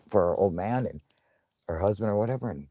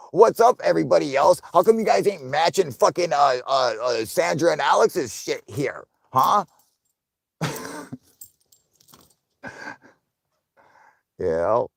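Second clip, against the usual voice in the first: high, loud, playful mock bossing of chat; hammed-up scolding for more money; mock demand; 6 semitones higher, 4 dB louder than usual. Streamer jokingly ordering viewers to match donations, loud mock demand. I'm joking or what that's from.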